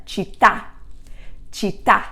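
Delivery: città with the stress on the final TÀ; stress on last syllable. Shown as a demonstration. Teacher showing students where città takes its stress, weight on the final TÀ. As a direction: lean into the end of città, rising on TÀ. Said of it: "make sure you emphasize it there".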